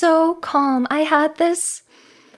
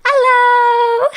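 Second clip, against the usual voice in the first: much higher and louder, held long; big excited hello; 10 semitones higher, 8 dB louder than usual. Acted. Streamer greets her returning viewers with a loud, excited hello. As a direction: belt the greeting out to the crowd.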